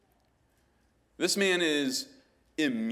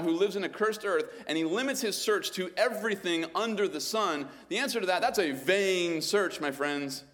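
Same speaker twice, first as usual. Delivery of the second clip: tag only; 8 semitones higher, 5 dB louder than usual. Real, not acted. Preaching rising.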